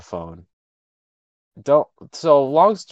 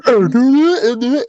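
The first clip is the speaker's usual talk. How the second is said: high, drawn-out silly scurrying noise; mock running noises; 10 semitones higher, 4 dB louder, slower than usual. Runner desperate for the bathroom, mock running noises. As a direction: voice a cartoonish bow-legged dash. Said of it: "and you're like".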